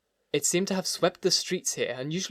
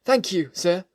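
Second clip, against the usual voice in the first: higher, louder, with a touch of triumph; note of triumph; 5 semitones higher, 5 dB louder than usual. A narrator's reading. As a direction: answer with restrained triumph, voice lifted. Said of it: "something like triumph in his voice".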